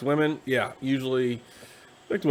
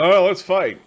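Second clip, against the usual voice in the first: louder, higher burst of mock bravado; eager brawler; 6 semitones higher, 9 dB louder, slower than usual. Knight in a bar jumping at a fight, loud mock eagerness.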